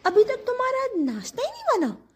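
high, put-on scolding voice; mock nagging husband. Wife mimics husband, high mock scold. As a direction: mimic a husband complaining about breakfast.

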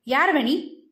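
mild stern rebuke, lightly voiced; a hint of a sharp scold. A narrator's reading. Officer scolding the boy, mildly stern. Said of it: "பையனை பார்த்து அதட்டினார்".

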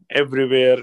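ordinary delivery

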